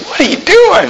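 high, incredulous inner cry; inner disbelief. Retold or imitated. Preacher watching the boy bite the pepper, high incredulous. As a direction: voice your own alarm. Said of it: "I'm thinking to myself".

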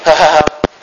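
put-on go-along chuckle; mock laugh. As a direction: imitate laughing along with the guys. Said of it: "I'm not just gonna say".

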